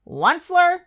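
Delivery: high, loud croaked cry of the name; croaking cry. A narrator's reading. Lorax cries out to the Onceler, high and loud. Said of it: "he cried with a cruffulous croak".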